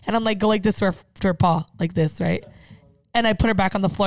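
normal talking voice

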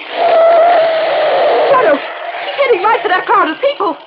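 high, alarmed shouting; urgent shout. Acted. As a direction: cry out a desperate warning. Warning as car speeds to crowd.

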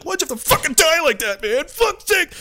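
high shout at a fallen friend; high grieving shout at the dead soldier; anguished yell. Retold or imitated. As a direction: yell out the grief and anger.

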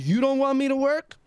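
high, rising indignant question; incredulous challenge. Retold or imitated. Employee challenging his disrespectful boss, incredulous. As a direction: throw it back as a disbelieving question.

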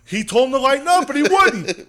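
high, loud, animated outburst; worked-up shout. Real, not acted. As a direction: shout it out in heated defence. Animated defence of the wrestler.

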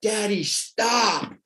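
high, slow, drawn-out plea; mock scream of a scared daughter; child's scream retold. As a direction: cry it out like a frightened little girl begging her dad. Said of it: "she just screamed".

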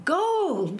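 high, thrilled cry of gold; children gasp out their find; high excited children's cry. Acted. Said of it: "they cried".

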